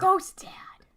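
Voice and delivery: ghostly whispered aside; spooky whisper. Acted.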